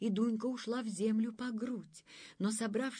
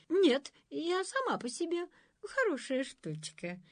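embarrassed; 9 semitones higher than usual. A narrator's reading. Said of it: "смущённо проговорила".

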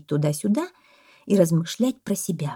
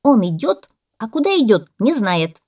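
loud, higher, emphatic; parrot exclaiming; 5 semitones higher, 8 dB louder than usual. A narrator's reading. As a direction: make the point loudly. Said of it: "воскликнул попугай". (восклицает попугай)

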